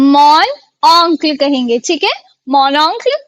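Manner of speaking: mon oncle spoken slowly and clearly, French nasal vowel; careful slow model of mon oncle; slow French demo. Shown as a demonstration. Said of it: "French में कहते हैं oncle".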